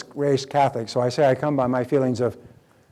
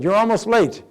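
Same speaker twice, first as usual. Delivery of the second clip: accusing; 7 semitones higher, 4 dB louder than usual. Retold or imitated. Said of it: "person said".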